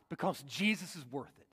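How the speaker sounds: softly, dropping the voice low; quiet first take. Acted. Preacher murmurs why Paul fights, quietly. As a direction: let it sink in, almost to yourself. Preacher repeats key line.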